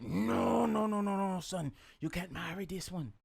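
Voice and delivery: Jamaican king refusing. Retold or imitated. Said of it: "Your accent is uncanny".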